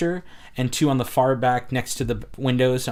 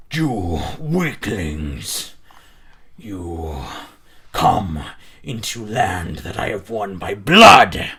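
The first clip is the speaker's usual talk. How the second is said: deep, slow, booming warlord; booming orc voice; 3 semitones lower, 8 dB louder, slower than usual. Acted. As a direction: roar it like a huge orc chieftain. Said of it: "the booming voice once again echo through".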